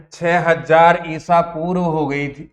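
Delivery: normal talking voice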